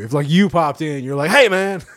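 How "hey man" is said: high, loud mock holler; imitated shout, pitched up; mock yell. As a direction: burst in hollering, playfully. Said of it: "just yelled that out".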